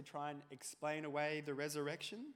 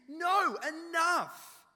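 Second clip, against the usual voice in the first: loud, high cutting refusal; angry Thomas; 11 semitones higher, 14 dB louder, much slower than usual. Acted. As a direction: slam the door on them. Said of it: "but Thomas".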